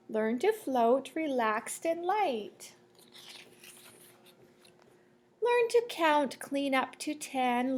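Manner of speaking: lilting sing-song picture-book read; reading to children. Acted. Teacher reading a rhyming book to the class, sing-song. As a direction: read the rhyme to the class, bouncing.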